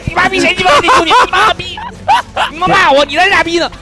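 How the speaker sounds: 高八度的破口大骂, 模仿当时的暴怒; 拔高嗓门学人骂街; 模仿骂街. Retold or imitated. Retelling a furious swearing rant. (尖声学骂)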